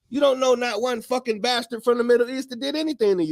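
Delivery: ordinary talk, nothing marked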